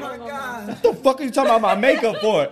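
hammed-up offended partner snapping back; retold angry outburst. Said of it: "she's sitting there like".

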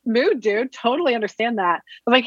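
normal talking voice

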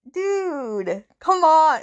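high, loud exasperated groan at him; exasperated dude. Retold or imitated. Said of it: "I'm like".

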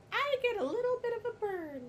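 high-pitched put-on silly voice. Guest explaining their skin in the sun, silly high voice. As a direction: pitch it up into a playful character voice. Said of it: "I don't know why I'm doing voices".

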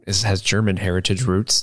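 normal talking voice

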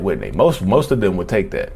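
ordinary talk

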